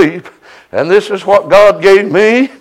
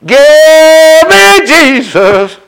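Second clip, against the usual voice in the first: preaching fervor; 7 semitones higher, much slower than usual. Acted. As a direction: stretch it out like a revival cry. Preacher exhorting the congregation.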